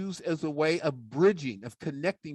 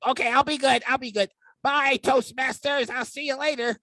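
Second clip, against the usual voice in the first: puppet sidekick; 9 semitones higher than usual. Acted. Puppet sidekick says goodbye to the Toastmasters audience in a high voice. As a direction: promise to be good like a cheeky puppet.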